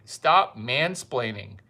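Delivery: ordinary talk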